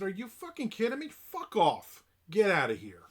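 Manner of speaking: exasperated, high, then loud dismissal; retold angry outburst. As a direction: re-enact blowing up at a doorstep caller. Retold angry rebuff at the door.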